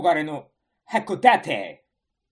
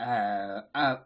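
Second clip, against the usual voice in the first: normal talking voice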